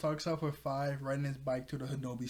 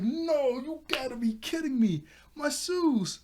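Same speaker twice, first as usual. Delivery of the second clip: high-pitched cry of disbelief; mock dismayed outcry; 10 semitones higher, 4 dB louder than usual. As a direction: wail it high in playful outrage. Retold dismay at losing the shoes.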